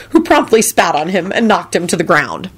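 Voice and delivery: ordinary delivery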